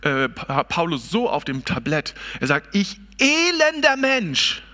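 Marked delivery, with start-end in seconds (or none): 2.7-4.6 slow, drawn-out lament on "elender", higher and set apart from the preaching voice. Retold or imitated. Preacher voicing Paul's lament, first slow and drawn out. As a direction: stretch out "elender" slowly like a groan of grief. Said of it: "da ist die Klage dann".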